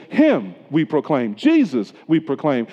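"Him" and "Jesus" stressed first, slowed; emphasis on him. Shown as a demonstration. Preacher showing Paul's word order, stress on "Him". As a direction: lean hard on "Him" and "Jesus" at the front. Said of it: "The emphasis is on boy".

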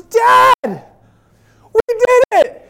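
very high, loud victory scream; imitated ecstatic kid. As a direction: mimic a son screaming in triumph. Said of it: "Zion is so excited".

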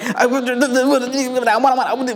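high, garbled rush of stammering; excited stuttering kid. Retold or imitated. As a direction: imitate a child tripping over words. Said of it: "so excited they can't get, like, the words out".

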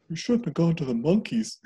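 a dad who has seen too much; haunted dad. Retold or imitated. Dad back from the monkeys, shaken and spooked. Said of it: "his eyes are like".

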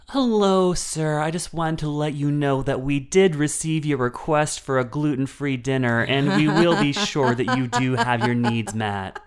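loud, high, overly bright announcement; too loud voice. Retold or imitated. Attendant announcing gluten-free meal, far too loud. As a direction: announce it for the whole cabin to hear. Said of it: "in a too loud voice".

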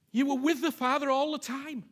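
ordinary talk, nothing marked

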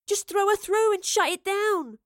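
high, urgent plea; young woman's high-pitched urgency; urgent pleading. A narrator's reading. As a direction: plead desperately. Daughter pleads during desperate struggle.

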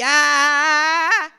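long, loud "aaah"; imitated scream. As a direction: hold out a mock scream. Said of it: "listen to the screaming".

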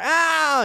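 high, drawn-out mock "ow"; imitated pain cry. Father imitating his kid hurt in the game, high drawn-out cry. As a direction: yelp it like a hurt child.

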